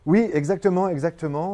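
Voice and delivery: Oui, exactement lancé plus fort et plus haut; enthousiaste. Real, not acted. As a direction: confirme avec élan. Eagerly confirms French Weeks. (oui enthousiaste, nettement plus fort)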